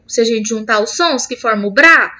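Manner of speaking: usual speaking voice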